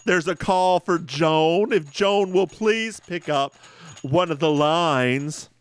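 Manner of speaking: loud, drawn-out public page; paging announcer. Acted. Paging Joan to pick up a line, announcer voice. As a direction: call it out slowly over the office.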